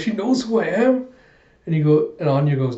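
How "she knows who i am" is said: high-pitched mock panic; freaking out. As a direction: act out alarmed disbelief, voice up high. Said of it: "he's freaking out".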